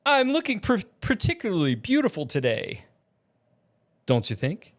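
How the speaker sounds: lightly voiced flamboyant show-off, high at the start; Summoner James. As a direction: preen a little, starting high and easing down.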